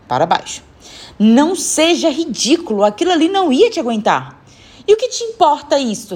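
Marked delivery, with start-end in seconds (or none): 1.2-4.4 higher-pitched, emphatic telling-off; exasperated scolding. Acted. Woman scolds boy over sheets. 4.9-6.2 high-pitched bold retort; cheeky defiance. A narrator's reading. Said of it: "retrucou com uma audácia impressionante".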